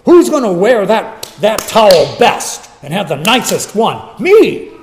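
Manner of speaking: loud, boastful squabble over who is best; mock bickering, punched hard; arguing disciples. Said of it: "Next thing they're arguing".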